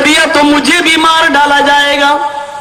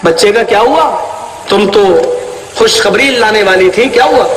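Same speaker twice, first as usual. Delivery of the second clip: lower, pressing question from the husband; a stern husband asking his wife; husband demanding; 6 semitones lower than usual. Retold or imitated. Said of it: "میں نے اپنی بیوی سے پوچھا". (شوہر کا نیچی آواز میں سخت سوال)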